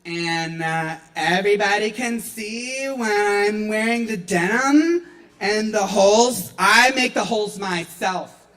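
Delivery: skater dude. Acted. Lightly voiced skater ranting about ripped jeans.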